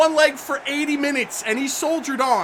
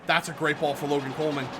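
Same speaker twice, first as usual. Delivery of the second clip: normal talking voice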